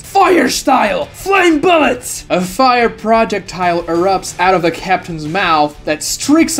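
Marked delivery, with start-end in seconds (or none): 0.0-2.2 high, slow shouted technique name; jutsu battle cry. A narrator's reading. Captain calling his fire jutsu, shouted. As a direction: call out the fire attack. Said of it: "weaves hand signs".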